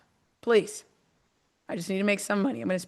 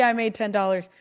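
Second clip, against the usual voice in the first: ordinary talk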